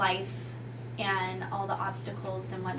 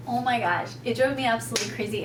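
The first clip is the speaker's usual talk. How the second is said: ordinary talk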